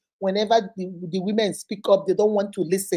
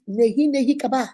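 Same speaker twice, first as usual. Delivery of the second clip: usual speaking voice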